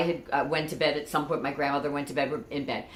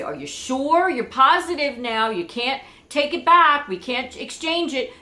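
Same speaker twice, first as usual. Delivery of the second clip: higher, louder teasing dad asking are you sure; dad checking; 8 semitones higher, 5 dB louder than usual. Retold or imitated. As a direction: playfully press her to be certain. Father double-checking daughter's choice.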